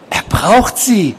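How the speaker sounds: very high, slow insistence; obsessed patient, pleading. A narrator's reading. Patient rooted to the spot urging nurse, high slow voice. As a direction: draw it out in a high odd voice.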